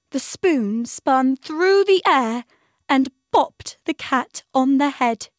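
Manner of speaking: lively read-aloud of the kitchen squabble; excited story narration. A narrator's reading. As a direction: read the tumble with rising glee. Builds the kitchen fight.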